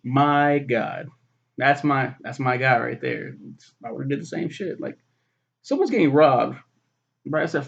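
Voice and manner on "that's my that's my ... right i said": ordinary talk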